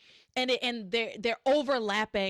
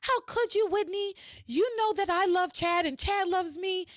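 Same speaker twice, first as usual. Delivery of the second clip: high, pleading mock yell; hammed-up accusing cry at the window; a jilted girl's mock wail, pitched high; 9 semitones higher than usual. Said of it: "She's outside yelling".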